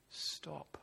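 quiet, solemn appeal; hushed plea. Acted. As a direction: say it low and grave, almost under the breath. God pleading with Israel to stop.

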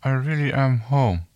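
lips pressed, words from the corner; ventriloquist-style, lips barely moving; talking out of corner of mouth. Pretend. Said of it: "trying to talk out of the corner of my mouth".

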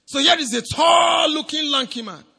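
high loud preacher's exclamation; dramatic shout. Acted. Preacher describing Goliath to the congregation, loud and high. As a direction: paint the giant with a big shout.

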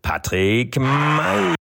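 slow, high, loud ring call; ring announcer. Acted. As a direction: stretch the name out like a fight announcer. Introducing guest like ring announcer.

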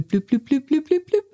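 high beeping bloops climbing up in pitch; imitated volume beeps. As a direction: mimic a laptop volume button being pushed up. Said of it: "you'd hear the bloop".

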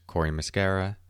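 ordinary talk

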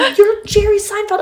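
starstruck disbelief. Retold or imitated. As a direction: gasp it out in disbelief. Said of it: "I go".

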